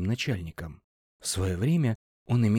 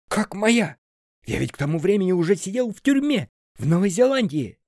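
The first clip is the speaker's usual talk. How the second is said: loud, high shocked yell; 11 semitones higher, 7 dB louder than usual. A narrator's reading. Jailed boss told it was his signature, yelling. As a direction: shout it in disbelief. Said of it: "заорал Сеня".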